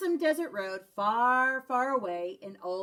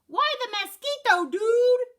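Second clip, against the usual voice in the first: high and loud, a squeaky little voice; tiny dog voice; 12 semitones higher, 7 dB louder than usual. A narrator's reading. The smallest dog pipes up at the stranger, high and squeaky. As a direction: pipe up like the littlest pup.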